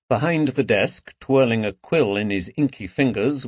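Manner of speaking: plain reading voice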